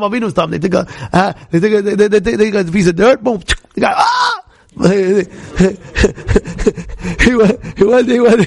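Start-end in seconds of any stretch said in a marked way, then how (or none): 4.0-4.4 high-pitched comic "ah!" scream; mock blast scream. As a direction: cry out as if blown away. Mimics man hit by blast.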